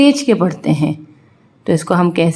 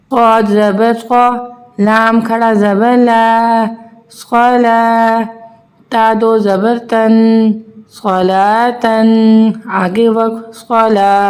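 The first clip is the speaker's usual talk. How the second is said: put-on drawn-out sing-song spelling drill for children; teacher slowly spelling out Arabic syllables for young pupils; slow letter-by-letter recitation; 3 semitones higher, much slower than usual. Shown as a demonstration. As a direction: chant each letter and vowel slowly, as in a Qaida lesson.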